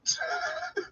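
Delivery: soft, high-pitched laugh; soft laughter. Real, not acted. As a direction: chuckle high and light. Laughing at no-car joke.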